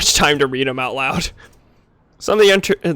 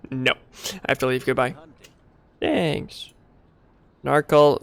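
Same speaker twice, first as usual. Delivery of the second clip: ordinary delivery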